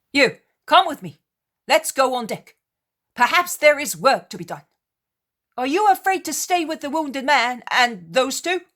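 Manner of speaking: high, loud call to action; rally him loudly over the storm. Acted. Peter calling the sailor up on deck, loud and firm. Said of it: "Then a little louder".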